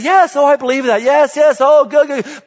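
high, loud, hammed-up gushing yes-man; mock eager agreement. Pastor mocking churchgoers who agree but never act, high hammed-up gush.

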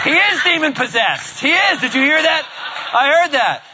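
high, loud mock alarm; excited exclamation. Real, not acted. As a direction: cry out in high-pitched, amused disbelief. Startled reaction to the unruly pig.

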